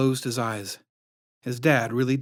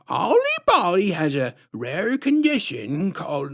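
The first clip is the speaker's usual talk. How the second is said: syrupy sinister clown woman introducing herself; creepy clown lady; 7 semitones higher than usual. A narrator's reading.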